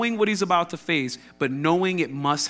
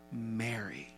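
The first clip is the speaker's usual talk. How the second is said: soft, tender single name, much quieter; endearing; 4 semitones lower, 14 dB quieter than usual. Shown as a demonstration. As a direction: say her name lovingly, barely above a breath. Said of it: "I read Mary very endearing".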